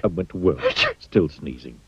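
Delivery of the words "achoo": high sneeze. Acted.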